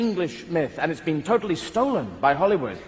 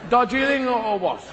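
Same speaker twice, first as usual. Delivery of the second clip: mock English gentleman.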